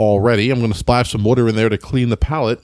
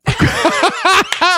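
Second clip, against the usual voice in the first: high burst of laughter; 3 dB louder than usual. Real, not acted. Laughing at a joke.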